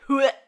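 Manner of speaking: "Hewitt" said in a deliberately odd way; playful pronunciation. Shown as a demonstration. Naming Jennifer Love Hewitt in an actor roll call, "Hewitt" playfully mispronounced. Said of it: "I like to pronounce it".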